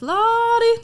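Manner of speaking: high, loud call of the name Lottie; grandma calling. Retold or imitated. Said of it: "she goes".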